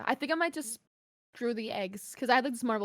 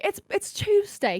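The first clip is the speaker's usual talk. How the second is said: British friend impression, raised pitch and drawn out; imitated British accent; 8 semitones higher, slower than usual. Quoting British friends on Tuesdays, mock British accent. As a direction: imitate a Brit, higher and slow. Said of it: "a bunch of British friends".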